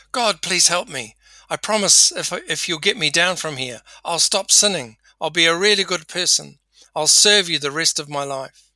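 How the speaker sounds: desperate pleading. Acted. Man on cliff begs God for help, pleading desperately. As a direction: bargain frantically for your rescue.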